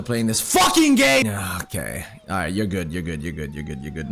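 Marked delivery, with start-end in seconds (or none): 0.4-1.3 sudden high, loud outburst; exasperated yell; real gamer rage. Real, not acted. Rages at the losing game. 1.3-4.1 low, soft, repeated self-reassurance; subdued, low reassurance after an outburst; calming self-talk. Real, not acted. Calms himself after the outburst.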